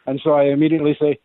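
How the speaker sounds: ordinary delivery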